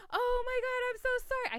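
very high retold apology; mortified apology. Woman apologising to the man she misdialled, mortified apology. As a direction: squeak it out in comic embarrassment.

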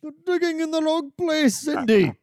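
squeaky Welsh accent impression. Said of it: "in your best Welsh accent".

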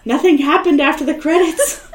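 real giggling disbelief; laughing at herself. Real, not acted. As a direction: crack up at your own mistake. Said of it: "what am I thinking".

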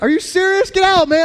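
high, loud mock outrage; Are you serious? shot up high and loud; disbelief. Pastor retells reacting to the traffic stop with mock outrage, high and loud. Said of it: "I was like".

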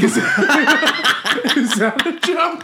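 high, laughing disbelief. Retold or imitated. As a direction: squeal it out through laughter.

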